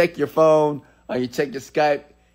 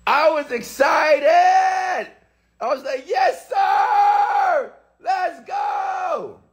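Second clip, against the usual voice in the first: excited shout; much slower than usual. Retold or imitated. Telling how the deal thrilled him, a high excited shout. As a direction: shout the joy out at full pitch. Retelling excitement over partnership.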